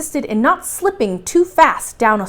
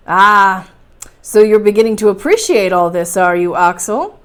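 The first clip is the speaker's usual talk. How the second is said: louder, lightly voiced uncle; knowing uncle; 2 semitones lower, 5 dB louder than usual. Uncle to Axel who now appreciates it, pleased and knowing. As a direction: open on a big satisfied "Ah". Uncle answers nephew's delight.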